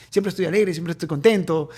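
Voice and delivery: usual speaking voice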